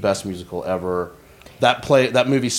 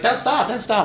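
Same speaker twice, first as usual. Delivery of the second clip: higher mock urgent plea; imitating boyfriend; 13 semitones higher than usual. Retelling the boyfriend's plea, high and mocking. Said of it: "He's like".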